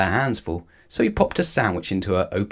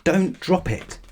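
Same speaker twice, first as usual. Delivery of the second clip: out of breath; 9 semitones higher, slower than usual. A narrator's reading. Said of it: "he gasped".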